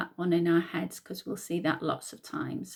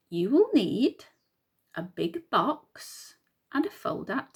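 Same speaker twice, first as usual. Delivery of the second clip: put-on reading to children; 3 semitones higher, 4 dB louder, slower than usual. Teacher reading what you will need, storytime read-aloud. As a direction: read out instructions slowly and brightly for small children.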